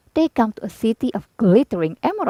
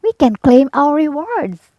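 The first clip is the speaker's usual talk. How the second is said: excited Dorothy; 5 semitones higher, 4 dB louder than usual. A narrator's reading. Dorothy triumphant.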